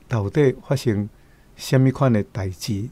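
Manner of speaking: ordinary delivery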